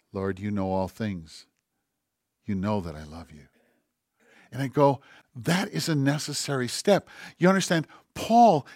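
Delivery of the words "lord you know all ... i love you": much softer, head hung, confessing love; Peter's broken admission, almost to himself; quiet humbled answer. Acted. Peter answering Jesus the third time, hushed and ashamed.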